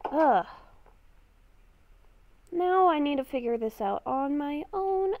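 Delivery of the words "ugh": ordinary talk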